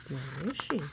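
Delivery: ordinary delivery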